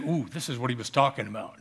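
soft, fast, hushed dawning; sudden realization. Retold or imitated. Disciples realize Jesus' meaning, hushed and fast. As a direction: let it dawn on you quietly. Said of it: "all of a sudden they said".

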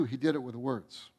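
soft echo of the earlier line; dropped to a hush. Real, not acted. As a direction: let the repeated line fall away quietly.

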